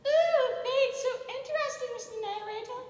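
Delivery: put-on high girly voice. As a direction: coo like a swooning girl. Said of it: "compliment yourself in a girly voice".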